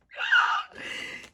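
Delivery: very high, loud gasping squeal; overwhelmed shriek. Real, not acted. Fan overcome by a cute photo, shrieking gasp. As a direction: shriek on a sharp breath.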